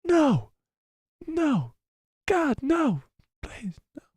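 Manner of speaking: very high pleading that fades away; Michael Scott. Acted. Streamer retrying a favourite meme after asking how he does it, high fading wail. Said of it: "Michael Scott doing that is the best".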